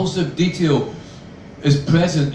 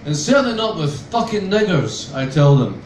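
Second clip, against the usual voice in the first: ordinary delivery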